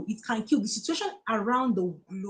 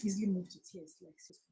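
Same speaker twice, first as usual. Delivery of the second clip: crying; 3 semitones lower, 6 dB quieter than usual. Real, not acted. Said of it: "I don't even know why I'm crying".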